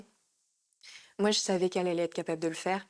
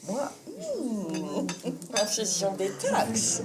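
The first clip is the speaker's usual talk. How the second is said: smug posh voice; much slower than usual. Retold or imitated. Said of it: "genre toute fière derrière".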